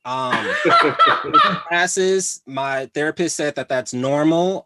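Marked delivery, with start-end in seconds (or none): 0.1-2.4 laughing. Real, not acted. Laughs through the admission.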